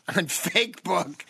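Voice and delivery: giggling through the word; can't hold back a laugh; laughing at own joke. Real, not acted.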